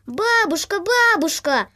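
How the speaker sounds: calling out. A narrator's reading. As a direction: call across the yard. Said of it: "закричал он".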